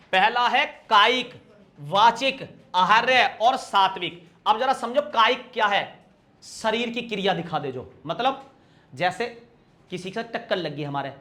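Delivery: ordinary talk, nothing marked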